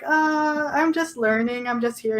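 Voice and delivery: a lightly voiced sheepish student; sheepish self-imitation. Answering the visiting author at Hamlin, mildly sheepish. Her sheepish reply to him.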